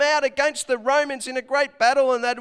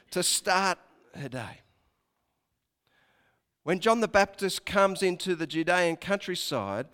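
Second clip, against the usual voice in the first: ordinary talk, nothing marked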